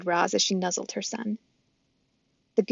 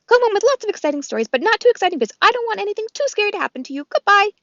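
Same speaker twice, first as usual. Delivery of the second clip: squirrel voice; 12 semitones higher, 7 dB louder, faster than usual. Acted. Chit Chat the squirrel.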